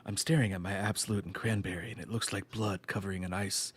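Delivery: low, soft inner monologue; quiet inner voice. Retold or imitated. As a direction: murmur it to yourself like a psycho. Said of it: "he's like".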